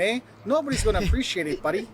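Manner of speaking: put-on Canadian accent with tagged-on eh and buddy; mock Canadian accent. Shown as a demonstration. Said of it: "don't try to talk with your Canadian accent".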